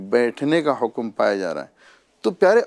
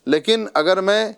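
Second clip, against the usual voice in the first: ordinary talk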